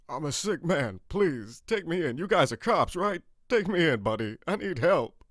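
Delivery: high, loud desperate begging; desperate pleading. Acted. Seamus begging the cops to take him in, desperate and loud. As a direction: beg to be arrested instead. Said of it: "he says in desperation".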